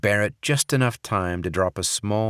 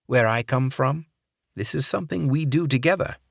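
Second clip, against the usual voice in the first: higher, quick fake English accent; 7 semitones higher than usual. Barrett urging Sokolov in fake English accent. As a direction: play the charming Englishman. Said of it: "in his fake English accent".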